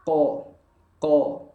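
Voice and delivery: "Ko" with the jaw dropping open, full and thick. Shown as a demonstration. Teacher demonstrates qaf fathah with open jaw. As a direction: open the mouth wide on "ko". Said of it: "rahang kita bergerak membuka".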